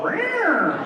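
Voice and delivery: high cat yowl, falling at the end; imitated high-pitched meow; cat sound. Shown as a demonstration. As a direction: screech like an annoyed cat. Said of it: "I forgot how fun it was".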